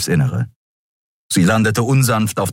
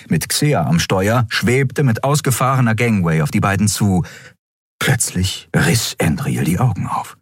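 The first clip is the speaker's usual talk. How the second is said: usual speaking voice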